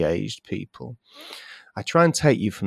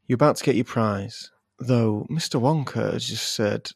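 ordinary delivery

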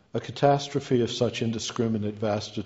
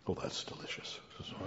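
breathy whisper after a sip; whispered aside; 15 dB quieter, faster than usual. Real, not acted. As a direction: whisper it to yourself. Just sipped water.

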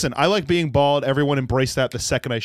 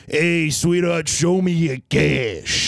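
slow sleazy New York guy; drawn-out greasy New York accent; gross New York accent; 3 semitones higher, much slower than usual. Retold or imitated. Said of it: "dueling gross New York guys".

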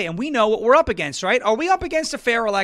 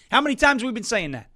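ordinary delivery

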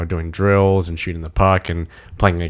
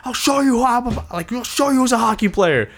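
high-pitched retold freak-out; imitated freak-out. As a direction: act out a frantic player, voice shooting way up. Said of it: "he's, like, freaking out".

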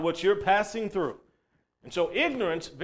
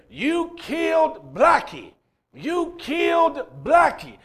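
put-on high, slow, anguished child's cry, raised pitch; retold boy screaming; 9 semitones higher, 5 dB louder, slower than usual. Little boy screaming at the evangelist over his dead dog, high and drawn out. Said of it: "a little boy running down the hill screaming".

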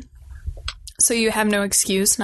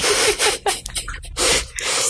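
breathy laugh; 11 semitones higher than usual. Real, not acted. Laughs at hook line sinker.

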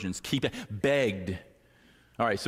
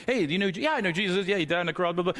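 breezy, offhand passerby, pitched higher; casual passerby; 8 semitones higher, much faster than usual. Retold or imitated. Stranger on the street answering the pastor, breezy and high. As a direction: toss it off like a careless stranger. Passerby asked about Jesus.

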